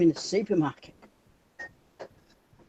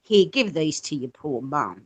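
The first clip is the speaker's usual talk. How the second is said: pitying softly; 8 semitones lower, 5 dB quieter, slower than usual. Retold or imitated. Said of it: "She said".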